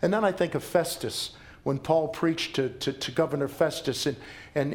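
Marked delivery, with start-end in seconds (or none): none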